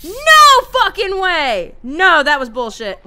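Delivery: long, high, loud cry of disbelief; outraged shout. Real, not acted. Player killed by a door in game, outraged shouting. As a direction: explode in protest, easing slightly after.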